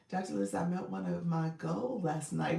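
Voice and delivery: ordinary talk, nothing marked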